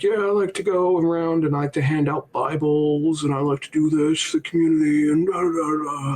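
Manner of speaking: put-on voice trailing into filler; imitating rambling old man. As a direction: ramble on as a mock old-timer. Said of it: "He's like".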